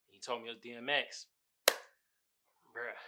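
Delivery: ordinary talk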